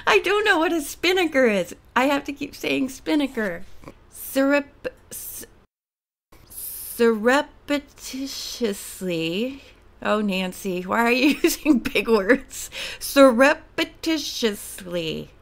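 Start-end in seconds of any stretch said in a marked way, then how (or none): 4.1-9.6 very slow, syllable by syllable; sounding out. Real, not acted. Sounds out a hard word. 13.0-15.3 slow, stretched-out big word; sounding out. Real, not acted. Repeats the big word slowly.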